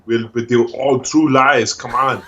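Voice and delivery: put-on action hero voice; Arnold Schwarzenegger. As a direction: do a comic Austrian strongman accent. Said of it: "Arnold Schwarzenegger, the good person test".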